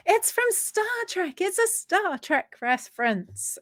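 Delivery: high-pitched, drawn-out mimicking of an eager crowd; mock high-pitched exclaiming; excited know-it-all chorus. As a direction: imitate everyone piping up, high and stretched. Imitating everyone excitedly spotting it.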